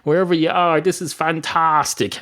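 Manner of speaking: high-pitched, grateful enthusiasm; thrilled congratulations, voice lifted high; delighted praise. Acted. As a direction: heap praise on an absent inventor. Dog gratefully praises Steve Jobs.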